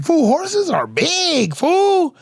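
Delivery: high, slow, hammed-up panic; mock scared. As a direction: plead like a man terrified of a horse. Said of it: "I'm scared".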